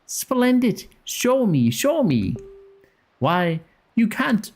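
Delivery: a hint of an eager shopkeeper accent; attempted character accent. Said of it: "I can't do his accent".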